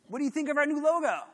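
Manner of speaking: high, loud cheery question; chirpy client. Retold or imitated. Client sliding over the paper, a loud cheery question. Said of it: "so he goes".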